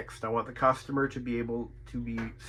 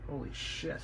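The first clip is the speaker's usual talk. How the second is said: a real breathless whisper of shock; whispered holy shit; 13 dB quieter than usual. Real, not acted. Repairman seeing the cracked board, whispering in shock. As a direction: breathe it out under your breath, stunned.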